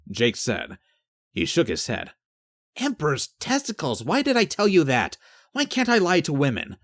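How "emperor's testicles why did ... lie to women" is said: exasperated self-reproach. Acted. Jake berates himself for honesty.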